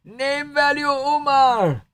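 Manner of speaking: high, slow, strained Merab voice; Merab impression. Streamer doing a Merab bit for chat, high and strained. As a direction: pitch it way up and draw it out like Merab. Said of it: "the Marab impression's pretty good".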